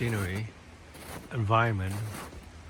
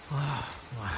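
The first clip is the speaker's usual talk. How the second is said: ordinary talk, nothing marked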